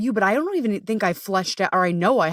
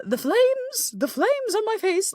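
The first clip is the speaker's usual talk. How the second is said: high, drawn-out dramatic wail about the flames; dramatic panic; slower than usual. Retold or imitated. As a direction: overplay it high like burning up.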